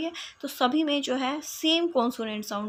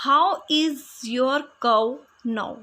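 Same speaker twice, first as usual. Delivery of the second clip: very slow and a little louder, word by word; slow example reading; 4 dB louder, much slower than usual. Shown as a demonstration. Teacher reads the example slowly to her class. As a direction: spell out the sentence slowly for listeners. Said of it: "अब इसमें how, ओ".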